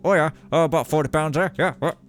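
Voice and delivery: put-on Canadian accent through clenched teeth, loud; gruff Canadian worker accent, teeth clenched; Canadian construction worker accent. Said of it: "they go ... teeth clenched together".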